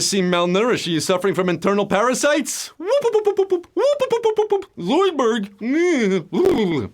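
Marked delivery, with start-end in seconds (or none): 2.8-6.9 high whooping cartoon alien, admittedly not great; a rough, hammed-up Zoidberg; Zoidberg impression. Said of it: "That's my Zoidberg impression".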